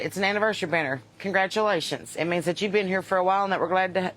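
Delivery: normal talking voice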